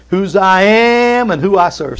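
slow, high call leading the congregation; preaching emphasis. Shown as a demonstration. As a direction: proclaim it for the room to repeat. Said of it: "Say that with me".

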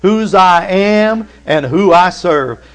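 high, loud, slow, every word hit; slow, high, loud proclamation; call and response. Shown as a demonstration. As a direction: lead the congregation in saying it. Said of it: "Say that with me".

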